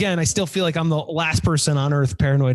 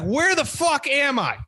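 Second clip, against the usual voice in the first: incredulous; 7 semitones higher than usual. Retold or imitated. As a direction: rant in disbelief. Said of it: "I was like".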